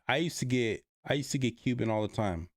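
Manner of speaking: usual speaking voice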